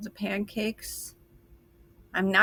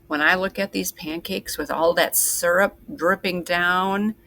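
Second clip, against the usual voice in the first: ordinary delivery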